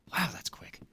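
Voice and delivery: whispered, pleased surprise; impressed whisper. Real, not acted. Player amazed at quick crafting, impressed whisper. Reacting to fast level.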